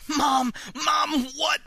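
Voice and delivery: desperate shout. Acted. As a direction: shout in shocked disbelief. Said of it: "I shouted".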